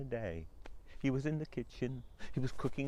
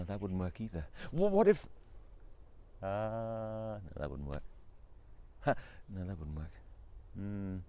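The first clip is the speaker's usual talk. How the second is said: lower, halting, self-rejecting murmurs; husband thinking aloud; 6 semitones lower than usual. Acted. Husband muttering ideas to himself, lower. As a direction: try ideas and drop them.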